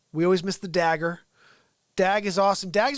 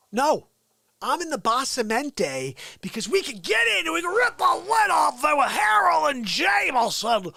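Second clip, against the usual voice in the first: loud; 8 semitones higher, 6 dB louder than usual. Real, not acted. Loud answer after the whispering.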